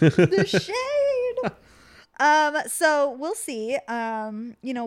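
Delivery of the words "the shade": high and loud, really thrilled; excited exclamation. Real, not acted. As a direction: call it out with delight. Repeating the remark louder.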